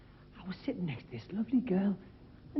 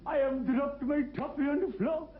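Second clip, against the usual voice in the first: fan at the match; 6 semitones higher, slower than usual. Retold or imitated. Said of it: "He said".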